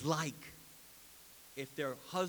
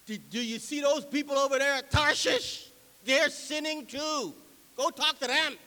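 raised, louder mock protest; loud finger-pointing; 10 semitones higher, 11 dB louder than usual. Preacher voicing Ninevites who blame Tarshish, in loud protest. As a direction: push the blame onto others, loudly. Acting out Ninevites blaming others.

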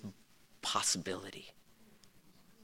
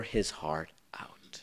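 whisper; 6 semitones lower, 10 dB quieter, slower than usual. Real, not acted. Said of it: "whispered in sermon".

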